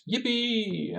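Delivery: put-on high, drawn-out kid's cheer, kept soft; innocent little boy. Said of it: "he was this little boy, uh, all innocent".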